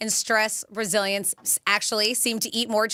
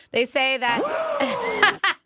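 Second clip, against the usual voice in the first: laughing; 10 semitones higher, much slower than usual. Real, not acted. Laughing at cheese secret.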